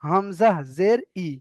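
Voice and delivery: হামজা জের ই slowly, short ই; slow drilled reading. Shown as a demonstration. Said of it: "জেরের পড়া". (ধীরে হামজা জের ই)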